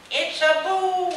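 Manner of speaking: high, slow dread-filled call; high stretched ominous cry. A narrator's reading. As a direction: cry it out high and drawn as doom lands. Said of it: "the ominous words".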